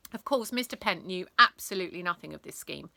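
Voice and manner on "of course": ordinary delivery, nothing marked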